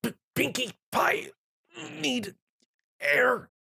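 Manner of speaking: strained, choked high plea; squeezed breathless. Acted. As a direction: gasp it out while being crushed. Crushed in bear hug.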